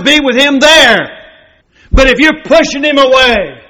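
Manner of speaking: sermon climax in a high, ringing voice; impassioned preaching. Acted. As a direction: preach it hard, pitched high and driving each clause home. Preaches at full force.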